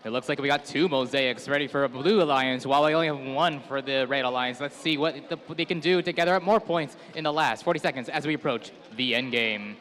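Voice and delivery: ordinary delivery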